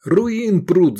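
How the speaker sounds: high, loud angry shout. A narrator's reading. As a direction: yell about the drug runners. Voronov keeps shouting.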